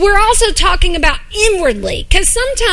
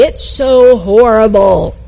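low, slow whining; slow low moaning complaint; whiny moan; 5 semitones lower, slower than usual. Acted. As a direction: drag it out like a whiner. Sermon acting out a whiner.

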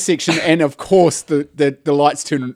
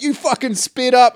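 higher, drawn-out accusation; retold angry accusation; mock road-rage accusation; 9 semitones higher than usual. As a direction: throw it out as a slow, higher-pitched jab.

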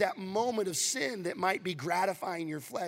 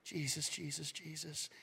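soft repeated prayer; 11 dB quieter than usual. Shown as a demonstration. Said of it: "you just repeat stuff in prayer".

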